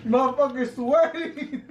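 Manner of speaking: slow words through laughter; laughing. Real, not acted. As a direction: laugh it out, slowly. Friend sweating from hot wings.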